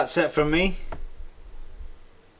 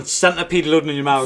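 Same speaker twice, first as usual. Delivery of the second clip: normal talking voice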